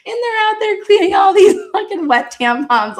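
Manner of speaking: high, laughing through the words; giggling at the picture of it. Real, not acted. Recalling the boys cleaning wet tampons, high laughing.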